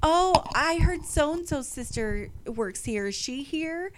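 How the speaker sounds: high, slow, sugary inquiry; prim vendor lady. Retold or imitated. Vendor asks about her sister, high and slow. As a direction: mimic the vendor lady's sweet tone. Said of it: "she comes in".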